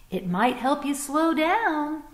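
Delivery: a kindly hopeful bunny; gentle bunny suggestion. Acted. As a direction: coax the fox to try a pose.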